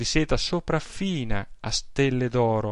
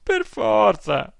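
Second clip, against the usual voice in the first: put-on higher, slow, emphatic old woman's cry; exclaiming; 9 semitones higher, 4 dB louder, much slower than usual. Aunt answering her nephew, a helpless higher exclamation. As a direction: burst out helplessly. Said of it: "Esclamò zia Velia".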